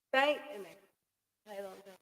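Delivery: ordinary talk, nothing marked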